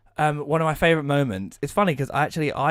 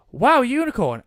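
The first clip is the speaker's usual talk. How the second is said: high, loud imitation of delight; excited wonder; 11 semitones higher, 6 dB louder than usual. Alex tells Max about the unicorn, excited wonder. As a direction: burst out with gleeful amazement. Friend's excited discovery retold again.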